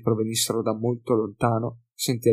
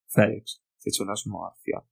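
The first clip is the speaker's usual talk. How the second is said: usual speaking voice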